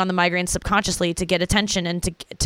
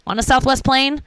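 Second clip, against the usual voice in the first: higher, louder, eager call to sit down; eager invitation; 5 semitones higher, 4 dB louder than usual. Retold or imitated. Comic inviting a fat passenger to sit by her, eagerly. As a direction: beckon the stranger over with glee. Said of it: "I'm like".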